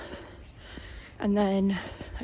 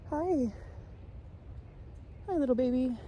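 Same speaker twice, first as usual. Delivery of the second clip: higher, gentle coo to an animal; 5 semitones higher, 3 dB quieter than usual. Real, not acted. Vlogger greeting a wild coyote, high gentle coo. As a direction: greet it sweetly like a pet.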